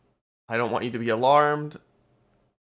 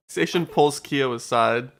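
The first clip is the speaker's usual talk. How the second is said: ordinary talk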